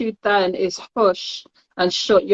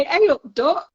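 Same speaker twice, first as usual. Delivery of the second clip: Nottingham greeting; 6 semitones higher than usual. Shown as a demonstration. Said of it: "lucky I didn't say".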